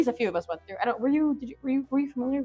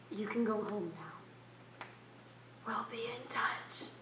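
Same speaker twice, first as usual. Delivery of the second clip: slow, hushed brush-off; dismissive whisper; 17 dB quieter, much slower than usual. Acted. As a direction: send them off under your breath. Dismissing auditioner.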